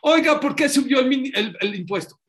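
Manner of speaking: high, loud protest to the king; complaining citizen. Acted. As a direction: whine it up high like an angry citizen. (ciudadano quejándose en voz alta y aguda)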